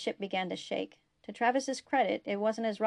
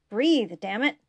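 loud, forceful plea; desperate command; 3 semitones higher, 9 dB louder than usual. A narrator's reading. Urging Malcolm to breathe after he stops, desperate command. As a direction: order a dying friend back to life. Desperate order to dying friend.

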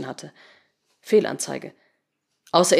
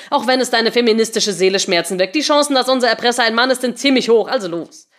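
raised, loud, pushy argument; needling insistence; 4 semitones higher, 5 dB louder than usual. Acted. Henry pushing reluctant Patricia to type, insistent needling. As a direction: press the point over the objection.

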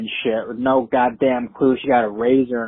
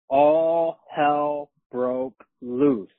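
drawn out very slowly, word by word; dramatic slow reveal; much slower than usual. Retold or imitated. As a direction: stretch each word for suspense. Dramatic climax of the story.

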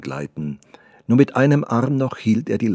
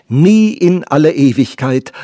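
"Nie" flung out higher and much louder; impassioned cry; 6 semitones higher, 10 dB louder than usual. Acted. The artist repeats his vow to her, now crying it out. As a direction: build the repeat into a full-voiced, passionate cry.

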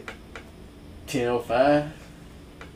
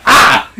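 short retold yell of pain, higher and louder; 8 semitones higher, 6 dB louder than usual. Yelling at the pump because his thighs hurt. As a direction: let out a sore-thighs yelp. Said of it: "I yelled, 'cause my damn thighs hurting".

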